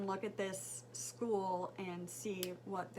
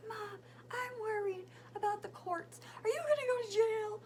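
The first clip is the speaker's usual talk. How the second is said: imitating worried son; 11 semitones higher than usual.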